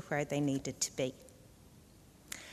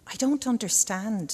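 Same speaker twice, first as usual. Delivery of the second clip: higher, louder puzzled slug; confused; 6 semitones higher, 6 dB louder than usual. A narrator's reading. Younger slug answers the crow, confused and higher. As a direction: blurt it out, bewildered. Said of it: "also confused by such a question".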